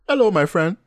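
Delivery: bright, cheery mock African accent; beaming welcome to a stranger; jolly African greeting. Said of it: "They make Africans seem very jolly".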